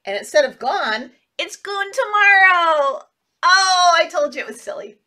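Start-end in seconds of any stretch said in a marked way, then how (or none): none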